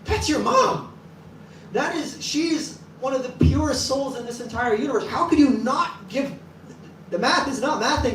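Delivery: high, frantic disbelief; devotees' alarmed outcry; freaking out. Retold or imitated. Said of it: "everyone's freaking out".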